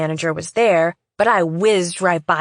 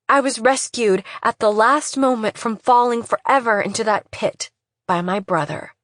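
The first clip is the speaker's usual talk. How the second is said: usual speaking voice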